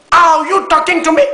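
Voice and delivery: put-on the De Niro line again, in a Martinique accent, high and loud. Host proving he can imitate De Niro, Martinique accent, high and loud. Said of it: "C'est la voix martiniquaise de De Niro".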